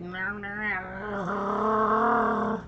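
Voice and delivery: gurgly zombie groaning. Retold or imitated.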